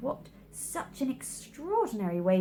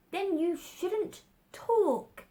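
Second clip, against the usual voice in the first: much higher, slow, rude retort; rude Hatter; 11 semitones higher, much slower than usual. A narrator's reading. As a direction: snub Alice as the Hatter.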